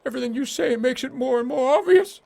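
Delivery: high, panicky pleading; a terrified seer begging off; panicked high voice. Acted.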